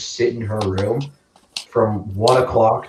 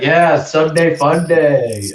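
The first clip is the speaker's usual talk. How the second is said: louder, drawn-out cheer; retold yell; 5 semitones higher, 5 dB louder, much slower than usual. Yelling back to someone on the river, cheering. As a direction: call it out slow and high. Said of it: "we kept yelling".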